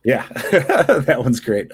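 amused, slow and appreciative; laughing agreement. Real, not acted. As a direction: laugh through the praise, slowly. Laughs while praising the cover.

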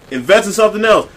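ordinary talk

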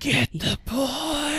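high, slow, eerie whispery voice; disembodied villain voice. Retold or imitated. Disembodied voice ordering Quirrell, slow and eerie. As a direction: draw it out like a ghostly voice. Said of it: "a disembodied voice kind of whispering".

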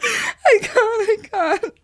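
high, breathless with laughter; can barely speak from laughing; laugh-crying. Real, not acted. Helpless laughter.

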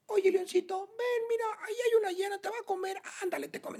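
very high, softening falsetto coaxing; mock gentle parent. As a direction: coo a useless warning at a little cub. Parodies gentle lion parent warning cub. (falsete agudo de mamá consentidora, en burla)

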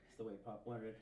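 quiet Fredo aside. Acted. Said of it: "the whole boat scene".

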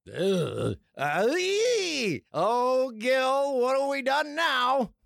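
high, wailing hammed-up cry; mock anguish. Reacting to the commercial put out, mock wailing. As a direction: groan rising into a drawn-out fake wail.

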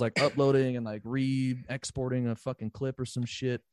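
usual speaking voice

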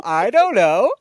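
loud, high, breezy I don't know; carefree shrug. Retold or imitated. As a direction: play it off, cringing at yourself. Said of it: "I literally go".

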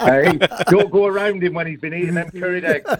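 high teasing line broken by laughter; laughing tease. Real, not acted. Scene: teasing Kempy on air about curried eggs, laughing. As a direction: rib him while cracking up.

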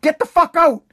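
a mock dad throwing the kid out; angry dad voice. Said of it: "like my dad would say".

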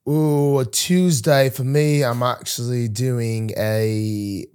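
put-on playful amateur London accent; mock London accent. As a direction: draw it out in a fake London accent.

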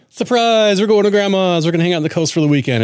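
put-on excited reveal to the kids; excited surprise announcement. Springs surprise trip on kids.

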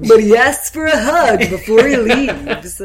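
loud announcer read with a laugh; radio voice. Pretend. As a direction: narrate like an over-the-top DJ. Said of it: "See? There's the radio voice".

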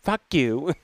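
low, dismissive kiss-off; quitting rant. Pretend. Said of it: "I'll be like".

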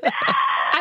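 mock fainting gasp. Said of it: "I'd be like".

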